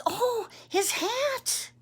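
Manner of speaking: put-on very high, slow child voice; little boy. Boy noticing the forgotten hat, concerned. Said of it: "he says".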